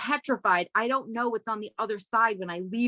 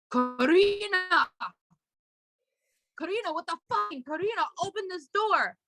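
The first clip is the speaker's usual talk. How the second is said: calling through a door; 8 semitones higher than usual. Retold or imitated. Boyfriend banging on stall door.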